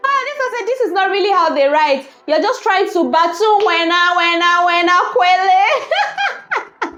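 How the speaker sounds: high, giggle-filled reading; laughing while reading. Real, not acted. Vlogger reading a teasing reply about South African tweets, laughing. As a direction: struggle through the words, cracking up.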